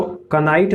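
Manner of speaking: कनाइट with k and a short vowel before the n; wrong sounded K. Shown as a demonstration. Teacher warns against this reading of knight, k sounded. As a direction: add a k syllable to open कनाइट. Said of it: "नहीं पढ़ेंगे".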